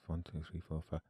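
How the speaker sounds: barely audible self-count; counting under breath. Acted. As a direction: check the count quietly. Quiet recount in English under breath.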